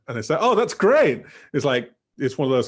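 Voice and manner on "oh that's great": much higher, louder gleeful cry; delighted. Shown as a demonstration. Friend glad a terrible couple broke up, delighted. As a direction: cheer the bad news with mock joy. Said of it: "kind of wishing for them to break up".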